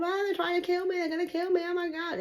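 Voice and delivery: high-pitched imitation of panicked wailing; mock panic. People tell you all the time.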